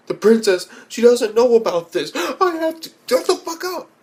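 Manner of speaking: squeaky high gasping panic; mock panicked princess voice; high mock girly voice. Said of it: "like, gasps".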